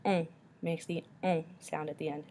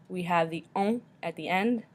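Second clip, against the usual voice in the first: ordinary talk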